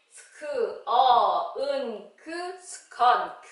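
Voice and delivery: "스크, 어, 은, 그" apart, then "스컨크" joined; phonics blending. Shown as a demonstration. Teacher reading SK words, slow sound by sound. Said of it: "에스케이 글자로".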